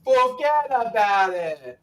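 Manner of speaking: forget about it stretched very slow as one word; New York accent. Shown as a demonstration. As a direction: demo the Brooklyn way, slowly. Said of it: "Sammy, you gotta say it right".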